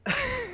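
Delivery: soft laugh. Real, not acted. Laughs at own joke.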